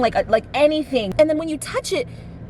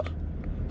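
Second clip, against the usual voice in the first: sharp breathless gasp of fright; startled gasp; 14 dB quieter than usual. Retold or imitated. Waking from a nightmare, a startled gasp. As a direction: suck the air in as if jolted awake.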